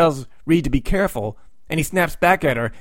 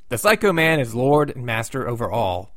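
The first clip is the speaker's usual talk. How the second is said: normal talking voice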